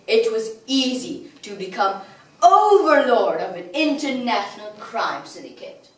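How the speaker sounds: usual speaking voice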